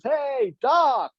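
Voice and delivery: high cheerful greeting call. Retold or imitated. Friend greets back loudly.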